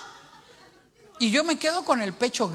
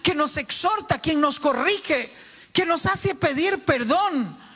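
raised, ringing sermon voice that builds to shouts; full-force preaching; 6 semitones higher, 4 dB louder than usual. Acted. Preacher exhorting the church, preaching at full force. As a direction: preach it out to the whole congregation.

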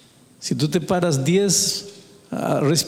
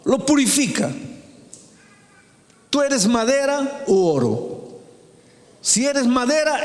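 emphatic high sermon voice with pauses; impassioned preaching; 9 semitones higher than usual. Acted. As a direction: preach it as a solemn challenge.

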